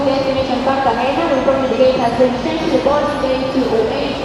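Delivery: echoing PA announcer voice; airport announcement. Real, not acted. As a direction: read it like a gate change over the speakers. Airport PA gate change announcement.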